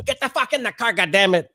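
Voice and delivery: put-on mother scolding son into car, light Mexican accent; mild mock Mexican accent. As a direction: snap it like an angry Mexican mom, lightly done. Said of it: "her Mexican accent flew out".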